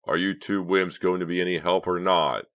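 groaning annoyance at useless helpers; annoyed. A narrator's reading. Leader fed up with both partners, plainly annoyed. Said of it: "Clay groaned, plainly annoyed".

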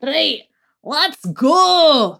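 high Scottish husband voice; gruff Scottish "shut it"; Scottish accent. Retold or imitated. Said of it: "In a Scottish accent".

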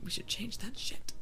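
whispered aside; whispering. Real, not acted. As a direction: whisper it under your breath.

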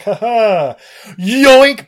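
loud, high cartoon-villain cackle and shout; gleeful villain cry. Villain showing up to snatch a brain, hammed-up gleeful shout. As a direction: crow it with mock triumph. Acted villain's entrance and grab.

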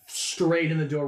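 usual speaking voice

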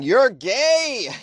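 high, loud, drawn-out cry; exaggerated accusing shout; mock accusation. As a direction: point the finger and holler it. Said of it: "a finger's pointed at them and saying".

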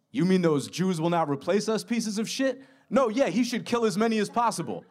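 a mock Larry David rant, voice up high. Larry David reacts on Zoom.

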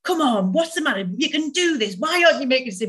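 mock drill sergeant scolding herself. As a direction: drive yourself on like a harsh drill sergeant. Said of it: "like a drill sergeant in my internal world".

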